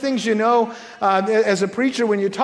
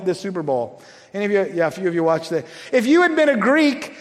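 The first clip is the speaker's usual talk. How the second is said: normal talking voice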